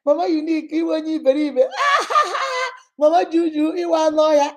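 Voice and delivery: high, loud teasing with hammed-up laughter; joking tease. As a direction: tease a friend and burst into shrieking laughs. Demonstrating teasing that both laugh at.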